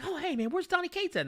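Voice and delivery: high, breezy fake-innocent greeting; casual greeting. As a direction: play it light and innocent.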